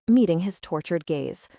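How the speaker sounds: ordinary delivery, nothing marked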